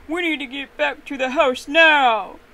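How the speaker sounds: urgent, drawn-out loud yell; big yell. A narrator's reading. Papa Bear to his husband after the alarm, yelling. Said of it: "exclaimed with a big yell".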